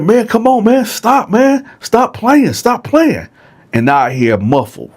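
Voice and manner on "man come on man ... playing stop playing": high, urgent repeated pleading; begging cellmate. Retold or imitated. Dylan begging Bone to stop playing, high urgent pleading. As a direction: beg him again and again to stop.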